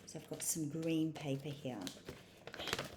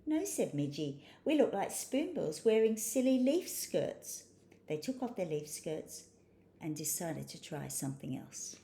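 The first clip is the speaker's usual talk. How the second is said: expressive, lilting picture-book read, higher on dialogue; story narration for children; 4 semitones higher, 5 dB louder than usual. A narrator's reading. Reading a spoonbill story to children, animated storytelling. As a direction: read to little ones with big emphasis on key words.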